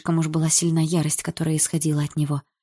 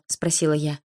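usual speaking voice

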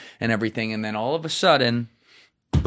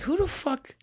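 mock disbelief; 6 dB quieter than usual. Analysts' shocked reaction, retold.

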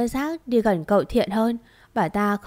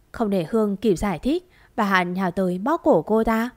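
ordinary delivery

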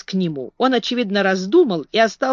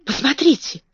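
excited whisper; 6 semitones higher than usual. A narrator's reading. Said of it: "возбуждённо шепнул он".

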